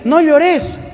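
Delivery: high, far-off "don't cry" in a friend's voice; a preachy, pitying friend telling her not to cry; mock consoling friend. A friend brushing off a crying woman, high and pitying. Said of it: "y uno le dice".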